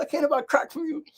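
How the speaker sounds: very high mimicking voice; imitated tipsy woman. Drunk Janae confessing as the niece laughs, high and effusive. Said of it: "She's drunk".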